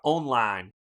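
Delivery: "Online" with the weight on On; Southern front stress. Shown as a demonstration. Streamer trying another Southern example, stressing the front of "Online". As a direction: hit the first syllable of "Online". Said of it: "the front of the word".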